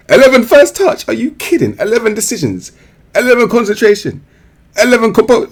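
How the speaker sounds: high, loud outraged disbelief at each stat; incredulous. Real, not acted. Reviewer reading out a player's terrible stats, high and outraged. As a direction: pitch every number up in disbelief.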